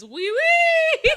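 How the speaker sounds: high, laughing "Oui, oui"; giggly high oui oui. Pretend. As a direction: squeak "Oui, oui" through laughter. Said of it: "I just went like this".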